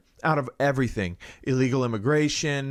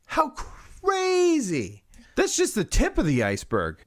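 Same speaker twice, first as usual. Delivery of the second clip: sarcastic; 10 semitones higher than usual. Acted. Sarcastic amazement.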